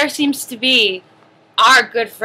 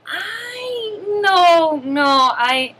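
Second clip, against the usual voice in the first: mock crying; 7 semitones higher, much slower than usual. Clown cries.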